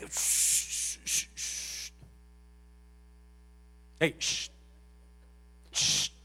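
sharp, repeated "shh"; insistent shushing. Acted. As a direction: hush someone who keeps trying to argue. Acts out God silencing the fighter. (siseo insistente para hacer callar)

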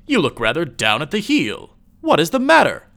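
loud, high-pitched lively question; exclaiming. A narrator's reading. Friend on the street asking after a downcast pal, exclaiming. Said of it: "exclaimed a friend".

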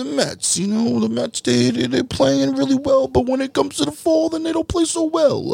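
high, hammed-up Italian accent; mock Italian sports fan voice. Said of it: "I'm working on my Italian accent".

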